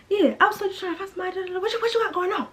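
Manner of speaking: dad on the phone accusing her, mock scolding; fast imitated dad. Dad's voice imitated.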